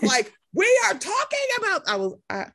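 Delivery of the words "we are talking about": mock yelling emoji. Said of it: "gotta have a little yelling emoji".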